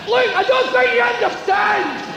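hammed-up cry of a man dangling over the sea; desperate shout for help. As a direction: scream for help from the cliff edge.

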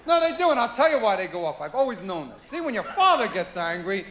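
usual speaking voice

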